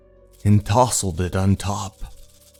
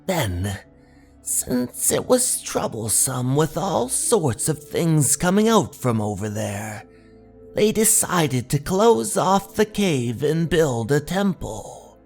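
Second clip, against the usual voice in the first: mysterious local stranger; 5 semitones higher than usual. Acted. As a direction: tell the local legend with eerie, lifted intonation. Stranger goes on telling local legend.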